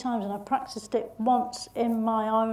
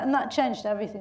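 usual speaking voice

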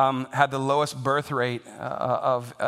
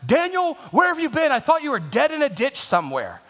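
frantic mom; 11 semitones higher than usual. Retold or imitated. Mother waiting up for her late son, high frantic shriek. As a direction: shriek it like a mom who's been worried sick. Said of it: "it's like".

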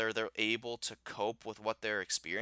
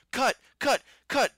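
high, loud mock yell of cut; imitating yelling; 13 semitones higher, 7 dB louder than usual. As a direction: bark out cut like a frantic director.

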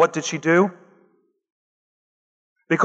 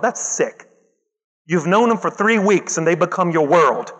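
ordinary talk, nothing marked

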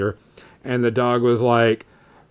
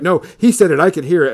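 put-on insistent kids; 6 semitones higher, 6 dB louder, faster than usual. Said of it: "they were like".

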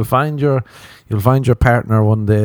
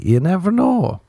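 ordinary delivery

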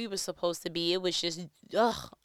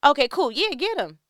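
excited; 8 semitones higher, 9 dB louder than usual. Real, not acted. Laughing, cheering again.

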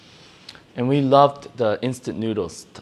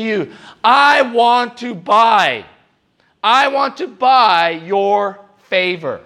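raised, smug voice buying God's favor; higher and louder, slowing on the last lines; Cain bargaining; 9 semitones higher, 5 dB louder than usual. Acted. Voicing Cain bargaining with God.

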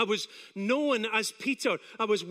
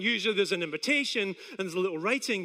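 ordinary talk, nothing marked

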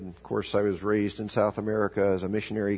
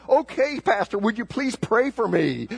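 high, loud desperate blurt; blurted plea; 10 semitones higher, 3 dB louder than usual. Retold or imitated. Lady on the turbulent flight begging the pastor, high blurted plea. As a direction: squeal it out like a terrified passenger finally giving in. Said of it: "she finally blurted out".

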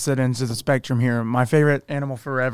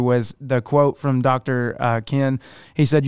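ordinary delivery

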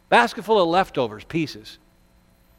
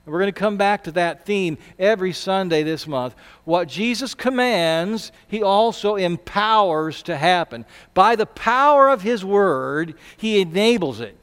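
ordinary talk, nothing marked

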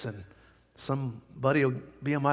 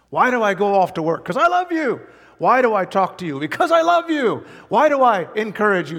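rising, loud preacher call and answer; preaching build; 5 semitones higher, 5 dB louder than usual. Acted. Pastor preaching his love to the church, loud and soaring. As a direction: hammer each question home with a soaring answer.

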